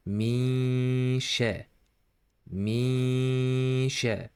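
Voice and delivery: "Mishe" with a long nasal hum blended into sh; hidden nun. Shown as a demonstration. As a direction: hide the n, humming through "Mi" into "she". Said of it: "You should hide this noon and blend it".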